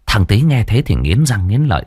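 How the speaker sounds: ordinary delivery, nothing marked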